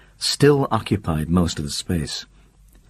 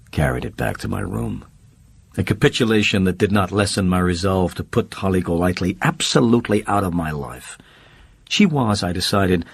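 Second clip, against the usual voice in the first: ordinary delivery, nothing marked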